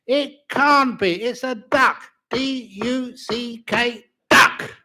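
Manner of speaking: hammed-up husband spelling duck; emphatic spelling. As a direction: spell DUCK out like a stubborn man.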